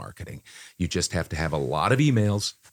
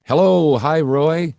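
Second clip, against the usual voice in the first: excited greeting; 9 semitones higher, 9 dB louder, slower than usual. Real, not acted. Greeting interview guest on call.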